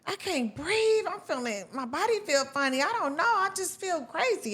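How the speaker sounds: high-pitched, imitating a panicked call to mom; retold panicked plea.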